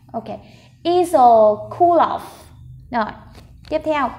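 ordinary talk

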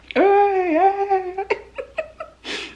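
long high-pitched squeal trailing into laughter; drawn-out, very high gleeful laugh; high delighted squealing laugh. Real, not acted. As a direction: squeal with delight, then break into giggles. Delighted reaction to the burger.

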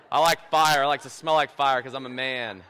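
chuckling through a tough-guy boast; joking about being a man who smells of fire; laughing mock macho. Real, not acted. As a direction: laugh while playing the manly man. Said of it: "joking macho bit".

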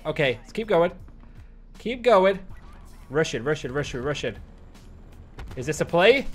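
ordinary talk, nothing marked

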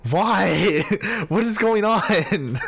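high, loud, laughing disbelief; baffled shriek. Real, not acted. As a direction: shriek your confusion through laughter. Baffled by strange messages.

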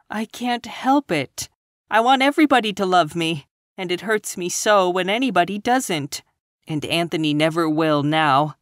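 put-on high, distressed young woman; tearful girl. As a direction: plead through remorse and tears. Anne's tearful voice held.

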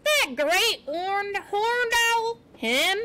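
high, slow, incredulous questioning; yelping. A narrator's reading. Porcupine astonished that it's the owl, yelping. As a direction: stretch it out in high disbelief. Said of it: "Aerith yelped".

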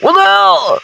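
high-pitched yelp of alarm; startled yell. Real, not acted. Player startled by Sonic in horror game, startled yell. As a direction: cry out in startled disbelief.

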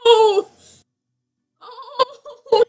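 loud, high wailing cry; wailing lament. Real, not acted. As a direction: wail in dismay, breaking into sobbing laughter. Lamenting a dead Sim.

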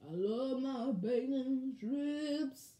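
very slow, drawn-out character drawl. Pretend. Imitating a pet who loves bacon, slow [name]-like drawl. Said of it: "I'm gonna imitate [name]".

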